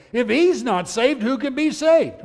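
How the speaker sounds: loud, high-pitched incredulous outcry; alarmed protest. Acted. Preacher acts out dismayed apostles questioning Jesus, alarmed protest. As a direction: exclaim it as if all hope is gone.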